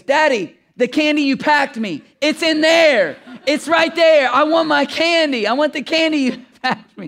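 put-on high, loud insistent toddler; a little girl loudly demanding her candy; daughter's voice. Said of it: "she goes".

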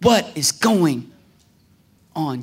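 drawn-out, word-by-word question to God; slow bewilderment. Acted. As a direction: ask it slowly, at normal volume. Question repeated slowly after the shouting.

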